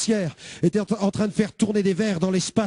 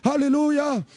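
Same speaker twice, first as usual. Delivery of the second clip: higher, rapt alleluias; ecstatic alleluia; 5 semitones higher, much slower than usual. Retold or imitated. Young man in trance answering the worried pastor, rapt. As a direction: sing out praise in a daze.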